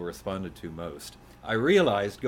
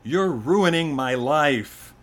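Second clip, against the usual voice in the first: loud, high, drawn-out wail of complaint; melodramatic outrage; 6 semitones higher, 10 dB louder, much slower than usual. Acted. Son blaming his father in a poem, melodramatic. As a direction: play the wronged kid to the hilt. Poem voices son's angry outbursts.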